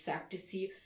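ordinary delivery

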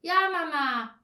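put-on high, loud child's reply; called-back answer. A narrator's reading. Boy answers his mother indoors, calling back. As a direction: call back dutifully. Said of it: "rief Olaf".

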